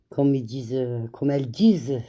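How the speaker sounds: ordinary talk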